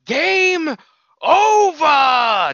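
high booming villain yell of game over; Raul Julia shout. Retold or imitated. Wrestler imitates Raul Julia's Street Fighter shout, high and booming. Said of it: "he yelled".

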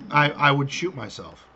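ordinary talk, nothing marked

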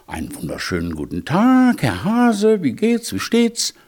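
high, singsong polite greeting; polite hedgehog. A narrator's reading. Hedgehog greets his arrogant neighbour the hare, politely. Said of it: "der Igel als höflicher Igel sagte".

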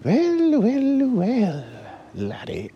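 very high, drawn-out cutesy poodle. Acted. Poodle boss greets the intruders in a fake cute voice. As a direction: put on a squeaky little cute villain greeting. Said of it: "some kind of cute voice".

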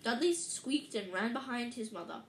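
usual speaking voice

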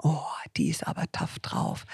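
whispered aside, voice dropped to breath; whispering. Retold or imitated. Retold thought of listeners.

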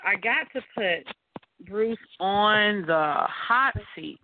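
ordinary talk

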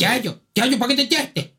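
high mock Canarian accent; high, exaggerated Canarian accent; Canarian customer. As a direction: imitate a hard-to-follow Canarian customer. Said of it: "y entra un fulano y dice".

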